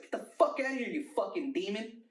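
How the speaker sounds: high, loud panicked yell, acted out; freaked-out yell. Tripping kid screams at friend's grandpa, acted freaked-out yell. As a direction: re-enact screaming at a demon, pitch shooting up. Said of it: "freaked out".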